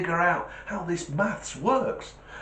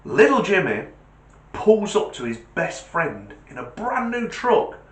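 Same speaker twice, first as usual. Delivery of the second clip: ordinary talk, nothing marked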